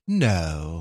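low, quiet imitation of a cool rebuff; mock deadpan refusal. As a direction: turn them down drily, dropping the pitch. Acting out Mary's curt refusal.

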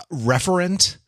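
normal talking voice

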